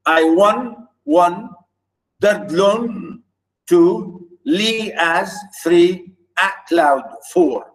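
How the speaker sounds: "I WAN, one, dered LONE, two", each foot split off; syllable pairs of "I wandered lonely as a cloud", each followed by its number; counting metrical feet. Shown as a demonstration. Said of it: "Iambic tetrameter means four".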